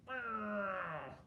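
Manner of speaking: ordinary delivery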